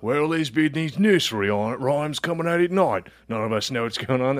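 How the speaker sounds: hammed-up British villager accent; Witcher villager. Villager telling the Witcher about nightly rhymes, mock British accent. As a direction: play a quest-giving game peasant in mock British. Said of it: "a blacksmith in The Witcher".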